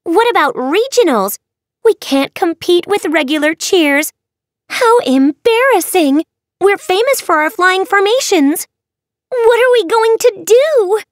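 very high, loud squealing cheerleaders; frantic high-pitched group lament; panicked crying out. A narrator's reading. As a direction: wail in escalating dismay. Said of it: "the cheer hexers cried".

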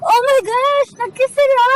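high, loud oh my gosh; shocked gasp. Acted. Friend spotting Regine kissing a boy, shocked squeal.

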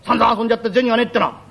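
ordinary talk

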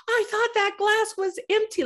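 very high, giddy mimicry; imitated hilarity. Actress mimics Tarantino after drenching her, giddy amusement. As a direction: squeal it as if it's hilarious. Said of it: "He started laughing even more".

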